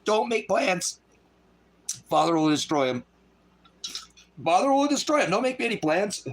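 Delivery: high preacher voice, repeating his warning; quoting Brother Ross. Retold or imitated. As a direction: mimic the preacher's solemn warning. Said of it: "Like Brother Ross says".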